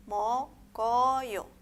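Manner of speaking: "mokoyo" as mo-ko-yo, drawn out, the k opening the second syllable; linked consonant. Shown as a demonstration. Teacher showing se va a unir, "mokoyo" read mo-ko-yo. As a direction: carry the k onto the vowel in "mokoyo". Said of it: "se va a unir a la vocal".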